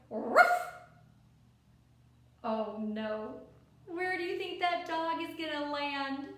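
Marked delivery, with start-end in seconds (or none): 0.1-0.5 loud sudden ruff; dog bark. Acted. Dog barks in story.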